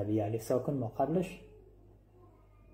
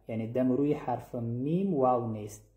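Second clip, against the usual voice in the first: normal talking voice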